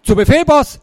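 loud, high salute to the boss; crisp high-pitched acknowledgement; eager obedience. A narrator's reading. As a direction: sound off like a keen recruit. Axel obeys the boss loudly.

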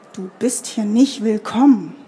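ordinary delivery

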